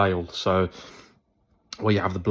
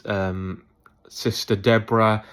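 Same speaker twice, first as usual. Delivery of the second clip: ordinary talk, nothing marked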